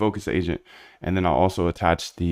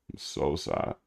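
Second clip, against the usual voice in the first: whispered, much softer mock sorrow; mock sad whisper; 4 semitones lower, 9 dB quieter, slower than usual. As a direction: breathe it out like fake heartbreak. Mock sadness at viewer leaving.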